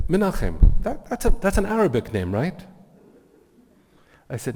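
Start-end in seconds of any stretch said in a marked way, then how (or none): 0.1-2.6 loud, high imitation of a Venezuelan man; rough Venezuelan accent, surprised questioning; badly imitated Venezuelan accent, raised voice; butchered Venezuelan accent. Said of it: "I'm gonna really butcher his accent".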